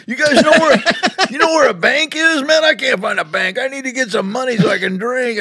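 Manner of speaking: high mock voice of a drifter; lightly voiced street drunk begging; imitating a drunk. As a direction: play the broke drunk asking for a bank. Imitating [name] asking for bank.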